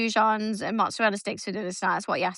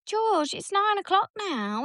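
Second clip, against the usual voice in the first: higher, slow, incredulous; exasperated mom; 9 semitones higher, slower than usual. Retold or imitated. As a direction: stretch it out in disbelief. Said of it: "And I'm like".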